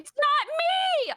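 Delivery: high-pitched, drawn-out outcry; real exasperated squeal of denial; indignant protest. Real, not acted. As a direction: protest your innocence, pitch shooting way up. Protests being accused by the group.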